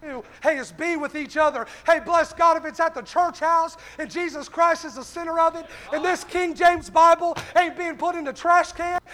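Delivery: fired-up preacher, punching every phrase; full-force preaching. Real, not acted. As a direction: drive each short phrase home to the congregation.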